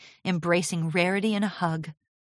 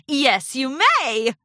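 drawn-out, higher, pleased reply; satisfied; 7 semitones higher, 5 dB louder, slower than usual. A narrator's reading. Apprentice letting Spike have the emerald, satisfied. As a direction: grant it slowly with a satisfied smile. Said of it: "replied with a satisfied smile".

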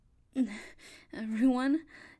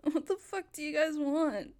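ordinary delivery

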